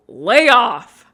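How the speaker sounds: drawn-out loud lay off; slow loud warning. Acted. Telling nagging friends to lay off, slow and loud. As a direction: lean on it slowly and loud.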